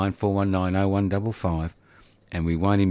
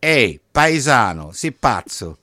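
mock Italian greeting in an Australian accent; hammed-up Italian with an Australian accent; 3 semitones higher, 6 dB louder than usual. Host mocks caller in Australian-accented Italian. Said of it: "with an Australian accent".

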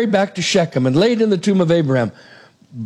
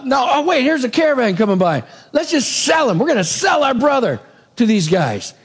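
brothers suddenly seeing a way to profit; excited brothers; 7 semitones higher than usual. Retold or imitated. Brothers spot a caravan and decide to sell him, excited.

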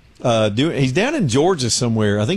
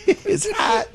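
mock whiny reporter. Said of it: "still imitating the reporter".

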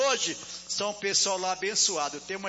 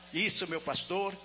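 usual speaking voice

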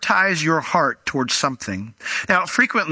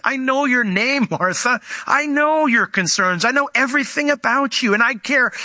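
high, insistent address to someone known; emphatic reassurance; 8 semitones higher than usual. Acted. Jesus telling Martha he knows her, with emphatic reassurance. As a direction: pitch it up and press each clause. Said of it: "he's emphasizing something".

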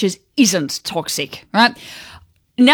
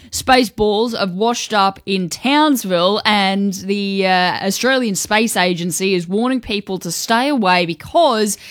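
normal talking voice

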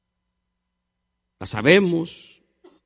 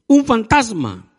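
raised, drawn-out cry of alarm, read aloud; mild fearful cry; 8 semitones higher, 6 dB louder, slower than usual. A narrator's reading. Disciples seeing Jesus walk on the sea, mildly frightened cry. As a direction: call it out slowly, higher and a touch alarmed. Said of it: "dieron voces de miedo".

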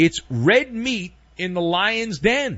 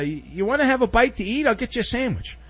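mock-friendly Kelly; 7 semitones higher, faster than usual. Mocking Kelly's sweet lunch offer.

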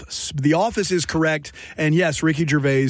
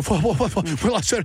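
imitated stuttering; 3 semitones higher, faster than usual. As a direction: stammer on one word, imitating an actor. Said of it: "like, stuttering".

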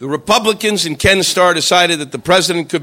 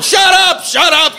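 high, pleading yell at the TV; exasperated shout; 10 semitones higher, slower than usual. Retold or imitated. Viewer tortured by long testimony on TV, exasperated shout. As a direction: cry out in comic desperation. Begging TV to stop.

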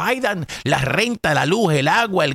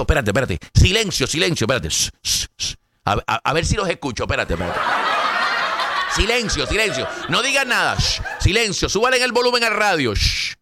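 mock shushing; 4 semitones lower than usual. Pretends to listen for republicans.

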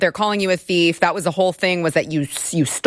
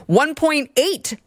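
high, drawn-out imitation of media alarm; exaggerated pundit voice, pitched up and slowed; mock media quote; 8 semitones higher, slower than usual. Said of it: "They'll be like".

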